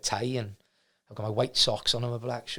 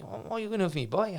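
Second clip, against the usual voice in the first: higher-pitched imitation of broad Leicester; broad Leicester accent; 7 semitones higher, 3 dB quieter, faster than usual. Club owner sizes up a fifteen-year-old job seeker, imitated broad Leicester accent. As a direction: put on the Leicester boss's voice. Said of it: "he's got this, like, broad Leicester accent".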